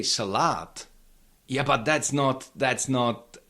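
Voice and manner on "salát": "salad" wrongly stressed on the long last syllable LAD; misplaced stress. Shown as a demonstration. Teacher showing the intuitive mistake, stress moved to end of "salad". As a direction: shift the weight of "salad" onto the final long A. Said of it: "to move the stress and say salad".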